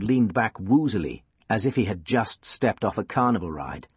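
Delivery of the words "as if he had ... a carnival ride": ordinary delivery, nothing marked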